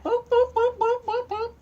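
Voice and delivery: high, bouncy boops for each leap; sing-song hops in a high voice; boop jump sounds. Pretend. Reading to a child, high bouncy boops for Peter's rooftop leaps.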